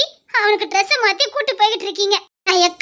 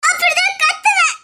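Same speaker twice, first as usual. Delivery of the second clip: defiant shout; 10 semitones higher than usual. Acted. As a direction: shout back at him through tears. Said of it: "கத்தாதடி, கத்தாதடி". (அழுகையுடன் அடம்பிடித்து கத்துதல்)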